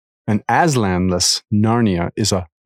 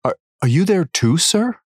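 put-on hopeful boy; 8 semitones higher than usual. A narrator's reading. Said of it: "said Edmund".

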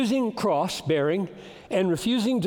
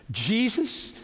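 ordinary talk, nothing marked

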